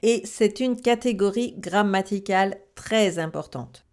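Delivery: normal talking voice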